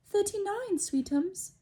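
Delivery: high, sweet mom voice; doting mother. A narrator's reading. Aunt Petunia helping Dudley count, high sweet voice. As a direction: chirp it lovingly. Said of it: "said Aunt Petunia".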